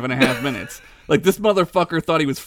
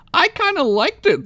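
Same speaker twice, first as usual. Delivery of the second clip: much higher dopey admission; stupid blobbit. Acted. Blobbit confessing he liked the song, high dopey voice. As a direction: say it like a dim little creature. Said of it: "admitted stupidly".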